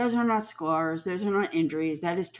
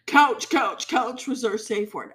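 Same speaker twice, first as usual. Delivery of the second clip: mocking quote; 4 semitones higher than usual. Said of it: "quoting her testimony".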